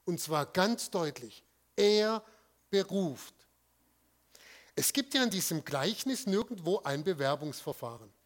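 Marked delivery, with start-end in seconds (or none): 1.8-3.4 "Er" louder and set apart before "beruft"; preacher hammering home that He calls; stress on Er. Shown as a demonstration. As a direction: punch "Er", pause, then "beruft". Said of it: "Auf dem Er liegt die Betonung".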